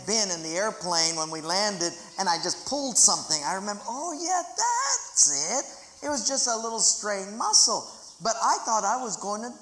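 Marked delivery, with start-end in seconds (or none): 3.9-5.7 much higher, sudden dawning voice; mock realization. Preacher recalling his realization, high mock dawning. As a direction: act out the lightbulb moment. Remembering the real cause.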